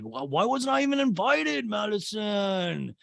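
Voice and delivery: high-pitched complaint, name drawn out; mock whine. Host recounting a disinvited guest's protest, mock whining. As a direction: imitate a hurt guest wailing at the host.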